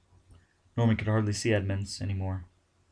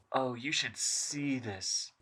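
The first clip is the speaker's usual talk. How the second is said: awed over intercom; 4 semitones higher, 7 dB quieter, much slower than usual. Acted. Edmund speaks over the intercom.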